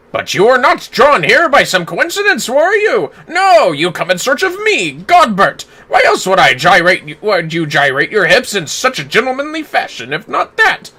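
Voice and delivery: jolly theatrical gentleman booming a laugh; voicing a cutscene hero with grand flourishes; hammy nobleman. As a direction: play a pompous, good-humoured father figure. Voices game character Godbert.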